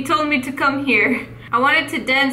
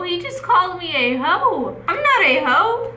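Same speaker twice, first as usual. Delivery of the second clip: high, swooping indignation; hammed-up outrage at an insult; mock offended protest; 5 semitones higher than usual. Telling viewers about a player's insult, mock offended and high-pitched.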